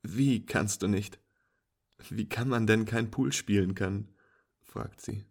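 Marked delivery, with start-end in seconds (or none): 0.0-4.1 amused female friend. Acted. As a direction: play her as an incredulous, teasing pool player.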